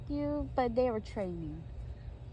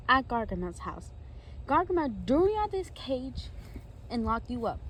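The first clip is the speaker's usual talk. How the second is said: ordinary delivery, nothing marked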